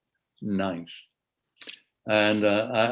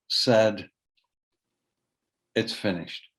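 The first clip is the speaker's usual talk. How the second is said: poem reading. Acted. Poet reads the poem about the mother of his kids, measured poetry reading. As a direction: read in spaced phrases with long pauses.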